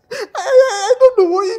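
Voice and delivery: tearful. Acted. Man weeping over lost manhood.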